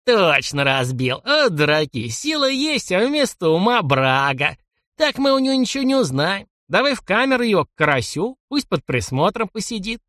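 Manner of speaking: put-on very old man. A narrator's reading. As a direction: berate like a crabby old man. Old man berating fools.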